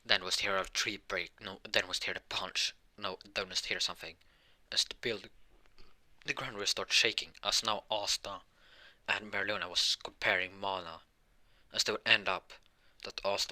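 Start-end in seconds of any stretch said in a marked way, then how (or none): none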